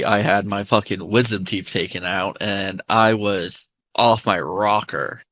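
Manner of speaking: ordinary talk, nothing marked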